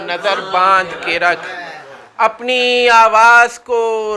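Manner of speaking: sustained declamatory Urdu verse; mushaira verse recitation. Acted. Poet reciting naat couplets to an audience, stately recitation. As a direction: let key words ring, settle on the refrain.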